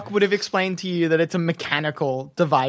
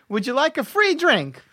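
friendly gnome barkeep; 6 semitones higher than usual. Acted. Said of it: "he says".

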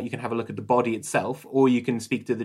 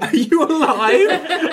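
mock high question; 8 dB louder, much slower than usual. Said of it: "GM voices asking corpse, repeated".

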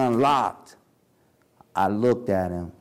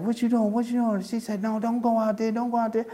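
much higher, pleading imitation of his wife; imitating frightened wife; 11 semitones higher, faster than usual. Narrator voicing his wife begging him not to go out, high pleading. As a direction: voice her panic as she begs him.

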